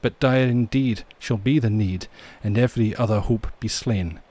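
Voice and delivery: measured Scottish ballad reading; Scottish accent. Acted. Narrator tells of MacLeod and the flag, Scottish accent. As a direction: recite the legend in a Scottish accent. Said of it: "do this in a Scottish accent".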